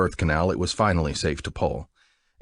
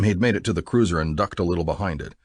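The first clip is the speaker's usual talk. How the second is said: plain reading voice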